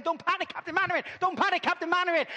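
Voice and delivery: high, fast panicky Corporal Jones; mock panic from the old sitcom; Corporal Jones impression. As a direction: flap about yelling like Jones from Dad's Army. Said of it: "like Corporal Jones from Dad's Army".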